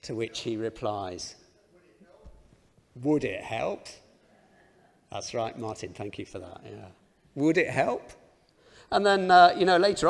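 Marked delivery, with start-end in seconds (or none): 3.0-3.9 put-on lightly accented spy reply, calm; a hint of a Russian accent, unbothered; mild Russian accent. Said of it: "I'm not gonna do the accent".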